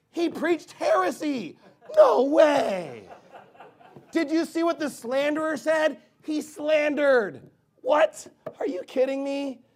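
high, loud hammed-up disbelief; mock shocked. Preacher mimics people trading heretic videos, mock shocked. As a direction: play an appalled gossip stretching out the key words.

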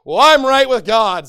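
loud, slow, smug declaration; mock smug believer. Pastor mocking a careless believer, loud and smug. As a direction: puff up with false piety.

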